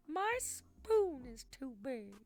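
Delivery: slow, high put-on voice; meme voice. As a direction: drag out the meme line.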